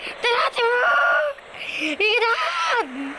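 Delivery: slow, high, crying scream as she rises; frightened scream. Real, not acted. Lifted up into the air, she screams in real fear. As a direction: let out a real terrified cry.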